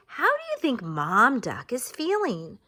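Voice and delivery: ordinary talk, nothing marked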